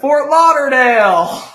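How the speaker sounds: high, loud, gleeful call-out; excited shout. Real, not acted. Kid calling out Florida places to a friend, excited shout. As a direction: yell it out like a cheer.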